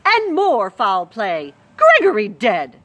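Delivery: loud, slow dramatic cry; shouted. Acted. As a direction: shout the terrible news. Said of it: "shouted the cook".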